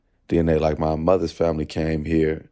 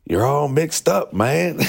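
thick Southern Texas drawl, pitched up; Southern Texas accent; 9 semitones higher, slower than usual. Retold or imitated. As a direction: imitate a Texas healer. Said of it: "like, Southern Texas accent".

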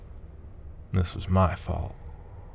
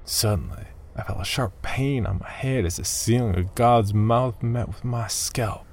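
ordinary delivery, nothing marked

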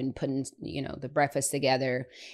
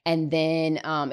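ordinary delivery

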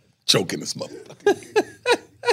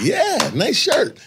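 mock dumb agreement; 13 semitones higher, 6 dB louder, much slower than usual. People mocking the shirt compliment.

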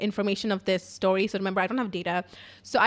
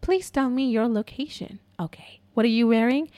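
very calm operator. Retold or imitated. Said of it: "very calmly".

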